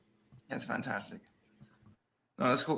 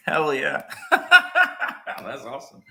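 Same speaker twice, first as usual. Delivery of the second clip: slow words through real laughter; laughing; 4 dB louder, much slower than usual. Real, not acted. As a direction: laugh while you say it. Laughs at hockey rink venue.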